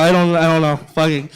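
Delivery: usual speaking voice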